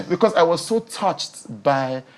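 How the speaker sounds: ordinary delivery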